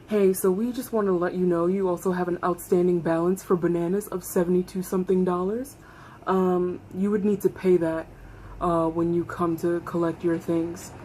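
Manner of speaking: low, soft, measured office voice; clinic phone caller. Retold or imitated. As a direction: quietly deliver the bill over the phone. Retold phone call from clinic.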